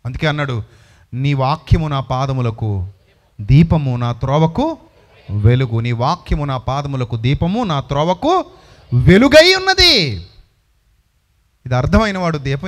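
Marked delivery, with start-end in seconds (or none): 1.1-5.8 slow, solemn reading of the verse; solemn Bible quote, measured pace; scripture recital. Acted. Quoting scripture slowly. 5.8-10.0 rising preaching climax. Acted. Repeats verse, building to climax.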